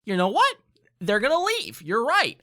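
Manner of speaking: high, bright mock realization; excited agreement. DM tells the player he's right, excited. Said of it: "And I was like".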